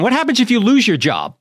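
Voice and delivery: normal talking voice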